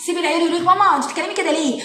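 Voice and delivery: ordinary talk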